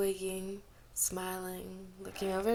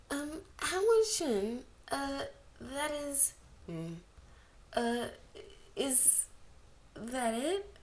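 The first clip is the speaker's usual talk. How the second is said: put-on higher, halting girl's question; hesitant girl; 7 semitones higher, slower than usual. A narrator's reading. Brave girl hesitantly asking Wangji if that's all, higher and halting. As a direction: stammer it out timidly, higher.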